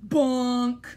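loud flat nonsense Bonk; silly bonk. Acted. Reader forced to say the last silly word, loud. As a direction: drop the word like a punchline.

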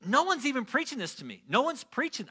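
high-pitched, incredulous protest; astonished new believer. Retold or imitated. New believer reading the Bible alone, astonished protest. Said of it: "look at the Bible and be like".

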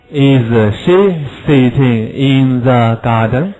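slow, clear question with rising end; drawn out so pupils can copy; slow model question. Shown as a demonstration. Teacher modelling a question slowly for the class.

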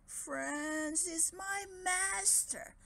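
high, slow, timid dragon voice; meek dragon. Acted. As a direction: answer meekly like a beaten dragon. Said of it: "the dragon replied meekly".

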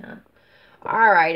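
normal talking voice

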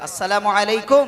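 a loud, slowed salaam that expects an answer. Shown as a demonstration. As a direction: call the greeting out so he must reply. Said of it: "উত্তর দিতে হয়". (জোরে সালাম, উত্তর চাই)

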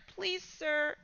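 soft, pleading plea; begging. Acted. Scared boy begging the angry father, soft plea. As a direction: beg quietly for mercy. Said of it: "Danny begged".

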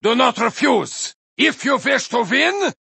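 shouted battle-hungry warrior boasts; brash Viking racer. Acted. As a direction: roar taunts at rival racers like a raider. Viking racer game voice lines.

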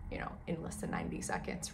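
hushed, fast whisper; whispered aside. Real, not acted. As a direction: drop to a quick whisper. Aside about question timing.

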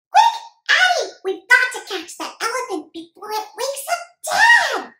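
put-on high, loud excited kid voice; urgent little boy on an elephant hunt; excited young boy.

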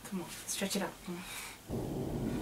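hushed coaxing. Real, not acted. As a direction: breathe it out gently to settle him. Coaxing baby to lie down.

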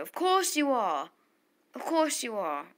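put-on slipping into an exasperated British accent; indignant British voice, "course" stretched high; lightly put-on British lilt. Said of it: "why am I British now?".

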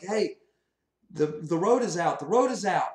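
alarmed warning. Acted. Passenger warning the bus driver the road is out, alarmed shout. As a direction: warn the driver urgently. Dreamer warns driver of broken highway.

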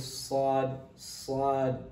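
sad without pulling the lips, no w sound; correct sad. Shown as a demonstration. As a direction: model a clean SAD, lips not pulled. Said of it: "The correct one is".

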